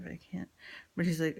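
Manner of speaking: ordinary delivery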